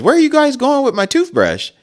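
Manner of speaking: hammed-up angry father; dad demanding. Dad demands who took his toothbrush, mock outraged shout. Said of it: "And he goes".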